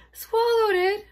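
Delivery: very high, loud alarmed cry. Acted. Maria hearing Danny swallowed it, alarmed cry. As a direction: shriek with worry. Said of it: "Maria cried".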